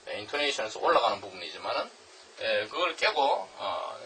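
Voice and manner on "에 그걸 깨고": normal talking voice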